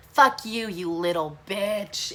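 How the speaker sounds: drawn-out, imitated caller's insult; retold angry caller. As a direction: put on the furious caller, stretching the insult. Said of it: "It's the guy".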